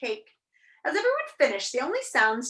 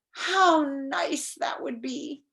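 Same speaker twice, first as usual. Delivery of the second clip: slow, drawn-out, higher musing; wistful; 5 semitones higher, much slower than usual. A narrator's reading. King imagining Rhyme and Reason back, slow wistfulness. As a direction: savor the idea slowly.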